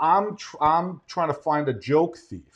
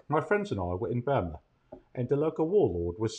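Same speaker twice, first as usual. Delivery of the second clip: put-on softer, storytelling British accent; quiet British butler telling an old tale; Michael Caine; 3 semitones lower, 8 dB quieter than usual. Butler recalling Burma to his employer, soft British accent. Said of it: "could you say it in your accent?".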